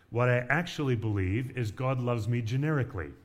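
ordinary talk, nothing marked

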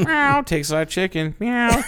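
high, slow silly meowing; high mock kitty voice; cartoon cat. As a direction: play a goofy big cat.

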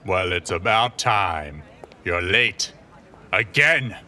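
angry boss. Acted. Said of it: "yelled the owner".

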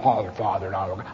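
low, soft prim mimicry; mock churchy voice. Mocking how church people talk, low prim voice. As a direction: sneer at how they talk.

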